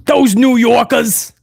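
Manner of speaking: hammed-up New Yorker, stretching the vowels; New York accent.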